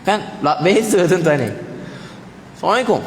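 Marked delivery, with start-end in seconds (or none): 2.5-3.0 rushed careless salam. Shown as a demonstration. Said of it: "Bagi salam pun tak, tak kena lah".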